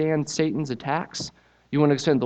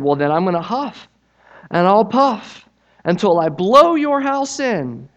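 cross shout; 8 semitones higher, 11 dB louder than usual. Acted. Wolf threatening the straw pig, loud and cross. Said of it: "the wolf shouted".

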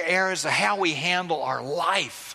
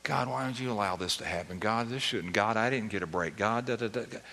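muttered grumbling; 9 semitones lower, 7 dB quieter, faster than usual. Retold or imitated. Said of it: "ultimately toward God".

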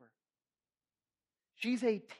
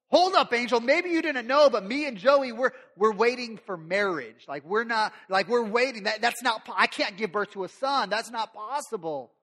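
loud, higher, flustered objection; flustered protest; 4 semitones higher, 6 dB louder than usual. Acted. Mary telling the angel she's waiting, flustered and loud. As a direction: insist it can't happen, loudly.

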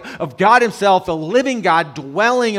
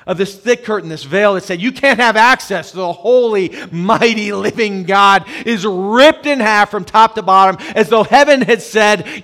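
loud, rising preacher's cry; fervent preacher voice, louder; preaching at full force; 4 semitones higher, 8 dB louder than usual. Acted. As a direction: proclaim it like a sermon climax. Sermon climax, louder.

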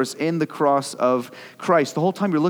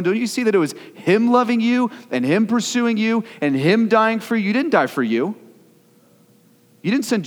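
high, urgent rhetorical questions; fervent, raised preacher's voice, driving each question home; impassioned preaching; 7 semitones higher, 4 dB louder than usual. Real, not acted. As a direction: plead with the congregation at full force. Preaches at full force.

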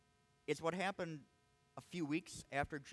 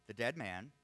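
usual speaking voice

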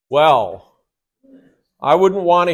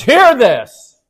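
HEAR pitched high, falling onto this; emphatic, higher-pitched call to attention on Hear; emphatic command; 7 semitones higher than usual. Retold or imitated. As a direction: punch the word Hear as an urgent command. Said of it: "That's an emphatic way of God saying".

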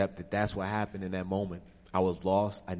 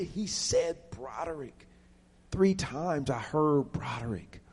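high, slow, insistent retort; 6 semitones higher, slower than usual. Retold or imitated. Son insisting to his doubting mother, slow high insistence. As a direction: stretch out each word to insist.

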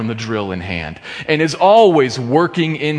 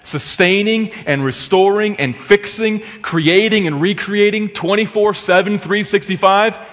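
higher and louder, building preacher's fire; climbing sermon crescendo, pitch raised high; rising preaching fervor; 7 semitones higher, 3 dB louder than usual. Acted. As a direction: lift it into a fervent proclamation, climbing. Preaching crescendo on God's work.

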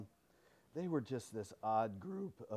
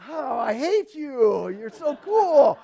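higher, louder, slow gushing envy; mock envious gush; 11 semitones higher, 17 dB louder, slower than usual. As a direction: whine it out in playful jealousy. I'm going.

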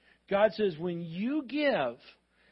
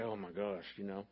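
embarrassed parent; 10 semitones lower, 10 dB quieter than usual. Retold or imitated. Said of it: "We're embarrassed".

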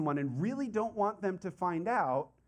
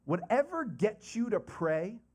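ordinary delivery

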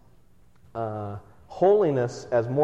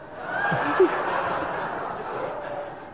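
high breathless giggle at his own prank; stifled naughty laugh; mischievous giggle. Real, not acted. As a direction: giggle under your breath. Sneaking the doll swap, laughing.

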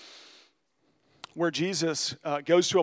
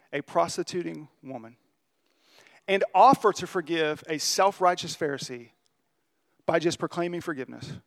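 ordinary talk

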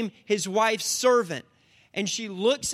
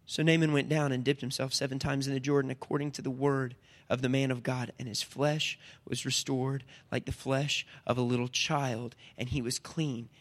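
soft scripture reading; 8 semitones lower, 7 dB quieter than usual. Real, not acted. Reads scripture softly.

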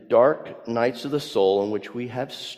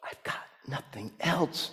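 much softer, thought aloud; quiet inner thought; 2 semitones higher, 10 dB quieter than usual. Retold or imitated. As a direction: confess it to yourself, hushed. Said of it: "I have a feeling Jacob thought".

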